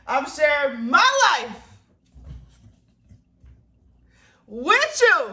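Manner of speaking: very loud, high, slow declaration; playful emphatic yell. Real, not acted. Vlogger insisting to viewers on sharing her life, playful yell. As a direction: holler it out, stretching each phrase. Repeats declaration louder, then laughs.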